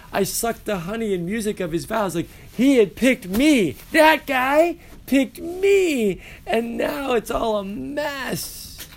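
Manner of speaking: high, slow lovelorn Ophelia lament; voicing Ophelia. Teacher plays heartbroken Ophelia to the class, high and drawn out. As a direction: wail it like a heartbroken girl.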